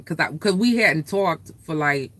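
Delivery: normal talking voice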